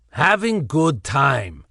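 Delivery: put-on slow, smug thick Slavic accent. Ixter answering Evol smugly, thick Slavic accent. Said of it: "in his thick Slavic accent".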